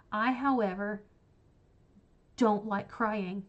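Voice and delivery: drawn out, every word spaced; emphatic slow aside. Real, not acted. Telling viewers she hates sad books, slow and pointed.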